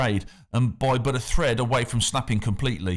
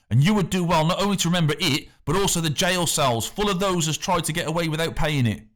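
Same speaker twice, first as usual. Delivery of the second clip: angry warning; 7 semitones higher than usual. A narrator's reading. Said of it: "turning angrily upon the boy".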